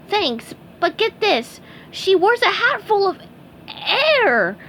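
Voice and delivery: high, slow, eager sponge voice; high cartoon sponge voice. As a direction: lean in and share the gossip. SpongeBob explaining in character.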